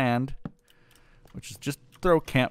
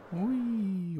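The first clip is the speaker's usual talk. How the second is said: playful squeal; 10 semitones higher, 5 dB quieter than usual. Real, not acted.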